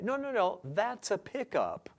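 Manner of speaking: high-pitched insistent objection; mock protest. Lyricist leaning on pickups, mock protesting.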